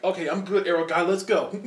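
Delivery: eager sidekick ready to fight; pumped up. Retold or imitated. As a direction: psych up a teammate. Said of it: "he's like".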